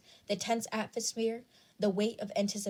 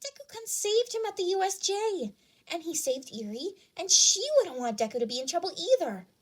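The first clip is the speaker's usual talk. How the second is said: put-on much higher girl's voice, loud at the end; a loyal friend pleading for Deku; earnest schoolgirl; 9 semitones higher, 3 dB louder than usual. A narrator's reading. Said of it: "Araka had chimed in".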